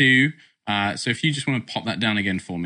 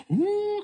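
high eerie drawn-out ooh; ghostly wail. Shown as a demonstration. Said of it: "kind of like this".

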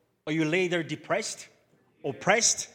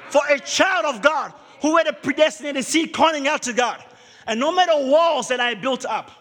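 loud, higher, forceful sermon delivery; preaching at full force; 10 semitones higher, 8 dB louder than usual. Acted. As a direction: preach it to the back row. Opening preaching, rising force.

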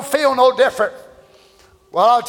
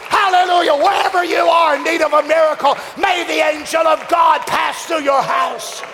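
preaching at full force; 3 semitones higher than usual. Acted. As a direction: lift the room with a shout. Climactic exhortation.

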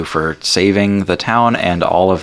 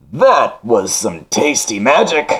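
slow, higher, lightly echoing call; Genie from afar; 5 semitones higher, slower than usual. Acted. Genie vanishing into the lamp, slow distant call. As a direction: draw it out as if calling from far away. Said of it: "from an echoing distance".

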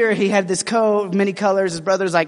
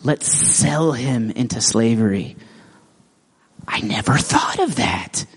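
scheming brother; 10 semitones lower than usual. Acted. Brothers plotting against Joseph.

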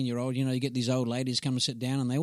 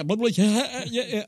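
loud, high-pitched babble; high, raised-voice nonsense jeering; acted classroom outburst; 9 semitones higher, 6 dB louder than usual. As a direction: rattle off a rowdy schoolboy's mock outburst. Acting out his classroom outburst.

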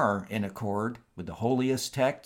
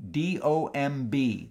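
slow, letter by letter; dictating; 2 semitones higher than usual. Shown as a demonstration. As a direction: spell it out slowly for a listener. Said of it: "that's the author's surname".

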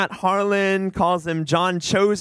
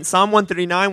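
ordinary talk

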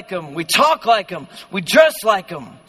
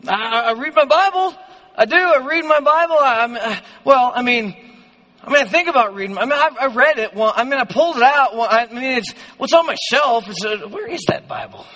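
higher-pitched, faltering mock excuses; defensive stammering; 4 semitones higher than usual. Preacher playing believer questioned on Bible reading, defensive stammer. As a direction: start sure, then stumble and backpedal. Acting a believer backing down on claims.

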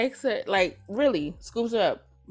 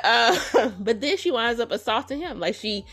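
ordinary talk, nothing marked

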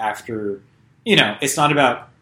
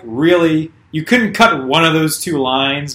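raised, louder voice of disbelief; incredulous reaction; 5 semitones higher, 7 dB louder, slower than usual. Retold or imitated. Reacting to two corny movie lines, incredulous. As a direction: challenge the writer in disbelief.

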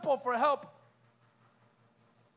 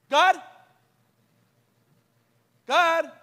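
louder questioning call into emptiness; 10 dB louder than usual. Acted. Preacher acting a desperate believer calling God, loud. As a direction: call God, getting no answer.

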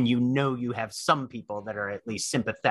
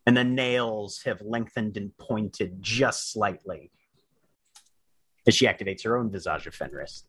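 slow, deliberate scene-setting with long pauses; game master narration. A narrator's reading. Game master describing a fighter stepping out, suspenseful narration. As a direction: build suspense phrase by phrase.

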